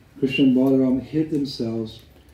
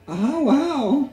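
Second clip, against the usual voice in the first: very high hammed-up wail; frightened cry; 12 semitones higher, slower than usual. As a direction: cry out high like scared little boys. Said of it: "they cried out".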